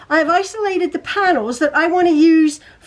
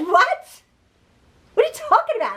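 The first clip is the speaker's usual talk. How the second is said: high-pitched mock surprise; incredulous retort, lightly acted; retold bafflement; 8 semitones higher than usual. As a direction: replay your baffled reaction, voice jumping up. Mock-baffled reply to son.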